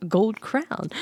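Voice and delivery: usual speaking voice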